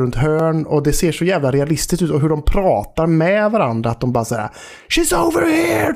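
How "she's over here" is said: high-pitched call to comrades; mock yell at normal volume; imitated enemy shout. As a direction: call out like a game enemy spotting someone. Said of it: "bara skriker till varandra".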